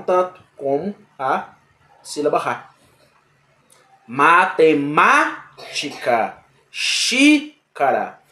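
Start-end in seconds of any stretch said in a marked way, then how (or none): 4.1-5.5 Matemática spoken slowly, stress on má; teacher modelling proparoxytone stress; slow stressed demo. Shown as a demonstration. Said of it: "teacher models stressed syllable". 6.7-8.2 Xícara drawn out very slowly, Xí high; teacher modelling proparoxytone stress; very slow stressed demo. Shown as a demonstration. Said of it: "teacher models stressed syllable".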